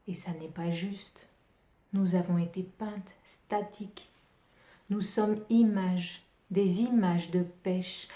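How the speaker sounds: slow, measured poetry reading with deliberate pauses; poem recitation. Acted. Poet reciting own French version of a surrealist poem, measured recitation. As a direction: recite line by line, leaning on key words.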